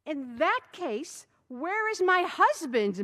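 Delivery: slow, loud, high rage. A narrator's reading. Wife demanding her husband in a rage, slow and loud. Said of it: "inquired in a rage".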